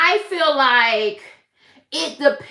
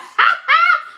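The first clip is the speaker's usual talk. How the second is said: bright high burst of laughter; giddy high laugh at the chat; delighted laugh; 4 dB louder than usual. Real, not acted. Reacting to Santa in chat.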